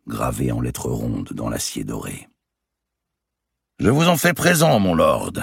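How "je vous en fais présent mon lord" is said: loud, high-pitched giant lord; booming giant. Acted. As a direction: play a swaggering giant of a nobleman. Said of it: "dit le géant au seigneur en noir".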